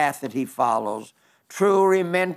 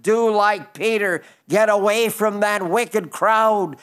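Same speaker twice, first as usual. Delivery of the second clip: raised preaching call to action; fervent exhortation; 3 semitones higher than usual. Acted. Preacher commanding listeners to leave the crowd, raised and urgent. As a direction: drive the command home to the crowd.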